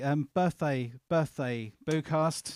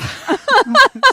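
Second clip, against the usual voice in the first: burst of laughter; 14 dB louder than usual. Real, not acted. Co-host jokes about prizes.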